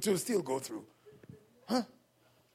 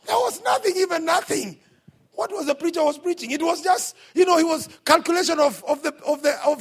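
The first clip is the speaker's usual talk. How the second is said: loud, high preaching climax; preacher raising his voice over the crowd; fiery preaching; 7 semitones higher, 6 dB louder than usual. Real, not acted. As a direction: drive it home to the congregation. Preaching at full force.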